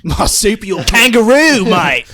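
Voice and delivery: loud, high broad Aussie; hammed-up Australian cry; mock Australian accent. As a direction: blurt it in exaggerated Australian. Escalating mock Australian words.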